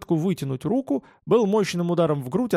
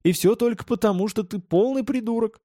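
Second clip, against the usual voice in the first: higher-pitched spiteful jab; angry, with pitch raised; angry insult; 7 semitones higher than usual. A narrator's reading. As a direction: throw the insult in a lifted, irritated pitch. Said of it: "также зло ответил я".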